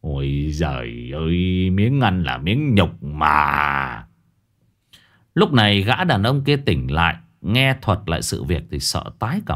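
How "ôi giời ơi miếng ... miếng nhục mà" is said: low, slow, drawn-out disgust; lightly voiced old master, shuddering. A narrator's reading. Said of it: "nghe xong thì rùng mình".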